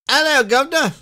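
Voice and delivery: high, loud put-on Victorian Cockney accent; a bad stage Cockney greeting. Player trying a Victorian Cockney accent for the stream. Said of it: "Victorian era Cockney accent".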